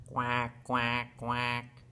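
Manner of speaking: low, level duck quacks; low-pitched imitation of a duck; duck quacking. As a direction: quack three times like a duck. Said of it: "Can you quack with Miss Debbie?".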